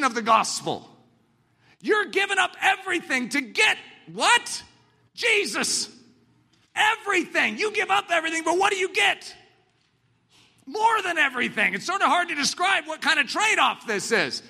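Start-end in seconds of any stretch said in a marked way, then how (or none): 1.8-11.7 high, ringing sermon shout; preaching at full force. Acted. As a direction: preach it out to the crowd.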